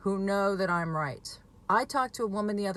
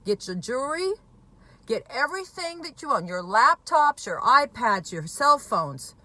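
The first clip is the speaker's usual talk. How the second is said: louder, urgent, slowed-down orders; urgent warning; 4 semitones higher, 6 dB louder than usual. Retold or imitated. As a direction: bark out a retold alarm to a worried mother. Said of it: "I told her".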